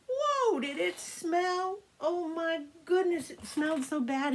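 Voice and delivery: put-on kids' story grossed-out wonder; disgusted exclamation. A narrator's reading. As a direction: act grossed out by the smell. Storyteller reacts to stink.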